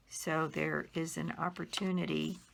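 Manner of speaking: ordinary talk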